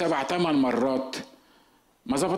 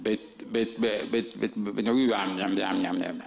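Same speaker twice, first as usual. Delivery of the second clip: low garbled stammering like a goose; goose-like garble; 3 semitones lower, slower than usual. Retold or imitated. Preacher mocking his own recorded voice, low goose-like gabble. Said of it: "تطلع عبارة عن وزة".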